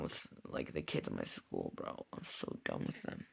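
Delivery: weary whisper. Real, not acted. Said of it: "tired at ten o'clock".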